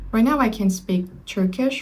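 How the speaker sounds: ordinary talk, nothing marked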